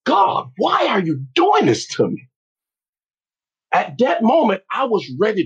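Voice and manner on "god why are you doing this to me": high, exasperated cry to God; shocked plea, pitched way up; disbelief. Retold or imitated. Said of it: "I'm like".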